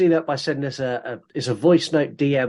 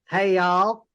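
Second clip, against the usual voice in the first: lightly imitated Southern twang, pitched up; mock Southern accent; 4 semitones higher than usual. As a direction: tease with a higher Southern greeting. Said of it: "I've spoken to other people and they're like".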